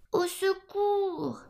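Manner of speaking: high, long-drawn piglet cry; cry for help. A narrator's reading. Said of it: "cria le petit cochon". (petit cochon qui appelle au secours, voix aiguë)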